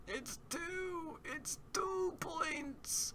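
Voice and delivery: squeaky high voice. Pretend. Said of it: "squeaky high insistence".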